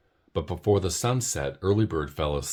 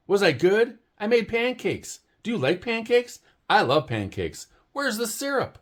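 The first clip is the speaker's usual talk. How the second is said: high, bubbly little bird chattering fast; an overexcited fan pestering her hero; eager Early Bird; 4 dB louder than usual. Acted. Early Bird pestering sleepy Owl, high and eager.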